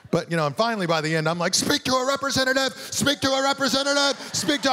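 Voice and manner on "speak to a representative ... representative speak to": high, strained, repeated demand into the phone; frantic exasperation. Retold or imitated. Fed-up caller to automated phone system, frantic high-pitched demand. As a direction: re-enact losing it at a robot operator.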